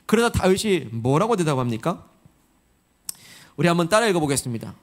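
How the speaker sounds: ordinary talk, nothing marked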